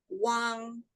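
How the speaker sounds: Wang high and level, held; first tone Wang. Shown as a demonstration. Teacher drilling the first tone. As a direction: keep Wang flat and high. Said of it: "First tone".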